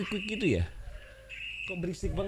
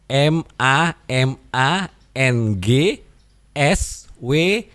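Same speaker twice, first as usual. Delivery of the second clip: slow, letter by letter; spelling out slowly; much slower than usual. Shown as a demonstration. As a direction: spell it clearly for them. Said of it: "namanya Mamang Suara". (mengeja pelan huruf demi huruf)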